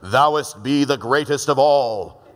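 slow, booming, pompous invocation; hammed-up old-fashioned holy voice; mock formal prayer. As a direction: intone it grandly, stretching every word. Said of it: "You don't have to come".

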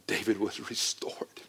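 reverent whisper; hushed unvoiced declaration; whispered awe. Real, not acted. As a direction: let it fall to a whisper. Climactic sermon line whispered.

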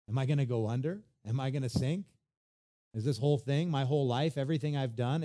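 ordinary delivery

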